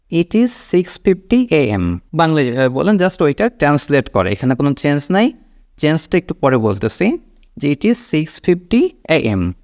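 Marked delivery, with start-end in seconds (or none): 0.1-2.0 very slow English time reading; slow model sentence. Shown as a demonstration. Teacher models telling time in English, very slowly. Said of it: "ওরকমই আপনি ইংলিশেও বলবেন". 7.7-9.5 drawn-out English time phrase; slow model sentence. Shown as a demonstration. Teacher repeats the time for learners, slowly. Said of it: "একদম যেরকম বলি ওরকমই".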